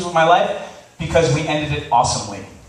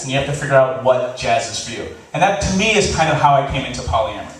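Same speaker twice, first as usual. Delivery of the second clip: usual speaking voice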